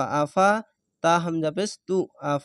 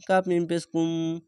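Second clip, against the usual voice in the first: ordinary talk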